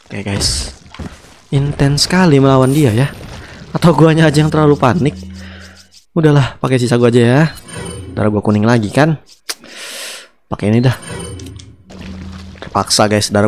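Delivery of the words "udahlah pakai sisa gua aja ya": ordinary delivery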